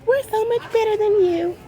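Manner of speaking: high mocking voice full of superiority; snooty mock voice. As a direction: mimic them looking down their nose. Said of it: "walking around with that attitude like".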